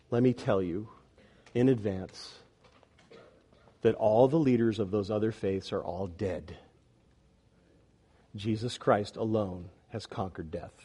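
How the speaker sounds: low, soft, deliberate sermon voice; solemn preaching. Real, not acted. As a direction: speak gravely, with long pauses. Solemn appeal to other faiths.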